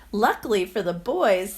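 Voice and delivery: ordinary delivery, nothing marked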